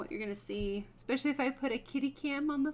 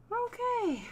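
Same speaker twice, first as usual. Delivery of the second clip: high squeaky okay; 12 semitones higher than usual. Real, not acted. Reacting in different voice.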